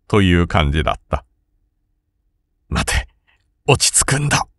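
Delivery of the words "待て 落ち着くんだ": hushed words to himself; whispering to himself. A narrator's reading. Rokubei whispers to himself on seeing his opponent, hushed. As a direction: whisper to calm his own nerves. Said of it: "と六兵衛は自分に囁きかけた".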